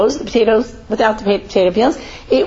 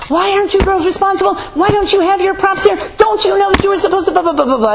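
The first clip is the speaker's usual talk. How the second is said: angry teacher yelling; 10 semitones higher than usual. Retold or imitated. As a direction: mimic a shrill scolding teacher. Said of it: "She yelled at them".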